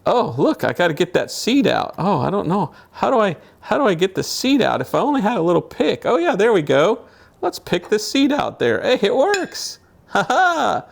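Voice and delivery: playful high voice. Real, not acted. Struggles with the peach seed.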